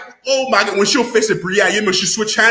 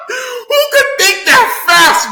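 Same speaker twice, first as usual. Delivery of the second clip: high, loud, slow through laughter; laughing amazement; 5 dB louder, slower than usual. Real, not acted. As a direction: squeal it out while laughing.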